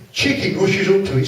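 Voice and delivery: ordinary talk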